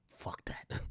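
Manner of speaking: soft unvoiced whisper. Real, not acted. Streamer to chat, whispered aside. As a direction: breathe it under the breath.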